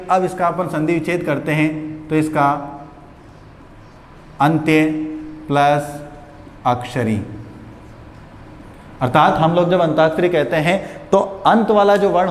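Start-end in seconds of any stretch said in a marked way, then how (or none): 4.4-7.2 अंत्य ... plus ... अक्षरी with long pauses between; split into parts. Shown as a demonstration. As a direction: spell out अंत्य, plus, अक्षरी one piece at a time, like dictation. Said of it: "एक का मैं define करके बता रहा हूँ".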